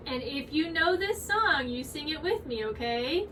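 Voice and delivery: gentle coaxing class voice; soft teacher talk to toddlers; kids' teacher voice. Acted. Teacher to kids.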